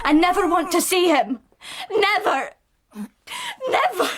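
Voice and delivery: rising refusal. Acted. New mother rising into a near-hysterical refusal of her baby.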